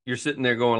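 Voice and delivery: ordinary talk, nothing marked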